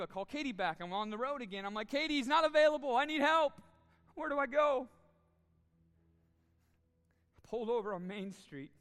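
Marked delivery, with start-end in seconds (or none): 1.9-4.9 raised, pleading panic on the phone; desperate plea. Retold or imitated. Pastor retelling panicked call to wife, loud desperate plea. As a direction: beg your wife for help, voice cracking high.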